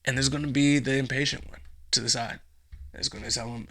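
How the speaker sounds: ordinary talk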